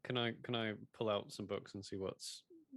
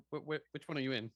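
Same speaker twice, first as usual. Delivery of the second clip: normal talking voice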